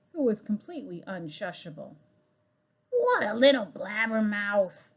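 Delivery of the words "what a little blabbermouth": ordinary delivery, nothing marked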